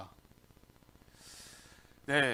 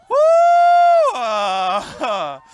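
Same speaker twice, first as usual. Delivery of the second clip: long, loud "woo" cheer; excited whoop; 12 semitones higher, 13 dB louder, much slower than usual. Real, not acted. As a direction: whoop for the crowd. Cheers the coming event.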